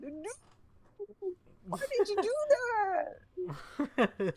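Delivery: put-on high voice, hammed-up complaint; mock whiny protest. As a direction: mimic a hurt friend protesting. Mimics friend after being smacked.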